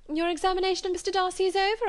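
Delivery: sharp society lady's jab; sneering lady. A narrator's reading. Miss Bingley taunting Elizabeth about Darcy, high and quick.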